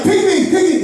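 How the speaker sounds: put-on high-pitched kid pleading to be picked; excited, high-pitched plea; eager begging. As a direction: beg eagerly for a spot on the team. Acts eager kid wanting picked.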